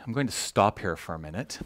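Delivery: ordinary talk, nothing marked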